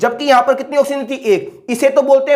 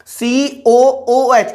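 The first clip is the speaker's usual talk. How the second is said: each letter of सीओओओएच stretched very slowly; drawn-out dictation; much slower than usual. Shown as a demonstration. Teacher dictating the formula to students, very slowly drawn out. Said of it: "teacher drawing out formula letters".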